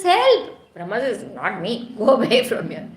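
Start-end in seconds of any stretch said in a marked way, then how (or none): 0.0-0.7 high, loud plea; hammed-up panicked yell; acted cry for help. Fleeing sage begs Brahma for help. 1.3-2.9 playful, hammed-up brush-off; comic refusal, getting louder at the end; acted refusal. Brahma refuses the fleeing sage.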